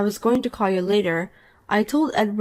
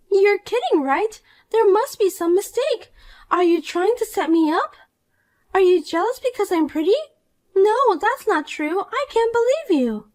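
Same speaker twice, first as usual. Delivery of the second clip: put-on a higher, bratty young woman in disbelief; Poppy, indignant; 11 semitones higher than usual. Rival wife-hunter scoffs at the married woman, higher and bratty. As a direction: play the spoiled rival who refuses to believe it.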